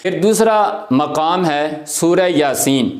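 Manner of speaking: usual speaking voice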